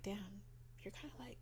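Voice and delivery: very soft, as if thinking it privately; hushed judgement. Retold or imitated. Quietly realizing a mom friend falls short, hushed. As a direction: trail down into a whisper.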